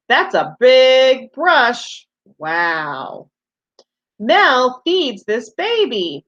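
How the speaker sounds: slow, lilting picture-book reading to little ones; reading to children. Acted. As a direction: read each page slowly, as if for small kids.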